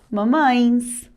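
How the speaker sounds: normal talking voice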